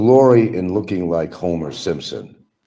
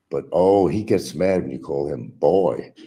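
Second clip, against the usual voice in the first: ordinary talk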